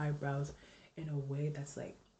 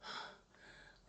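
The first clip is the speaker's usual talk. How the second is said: breathless whispered gasp; gasping, squeezed by a tight corset; gasping for air; 17 dB quieter than usual. Real, not acted. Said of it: "body going with every breath I take".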